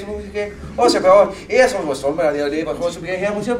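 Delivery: high, loud, garbled Newfoundland accent; hardcore Newfie accent. Shown as a demonstration. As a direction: parody a thick, unintelligible Newfie.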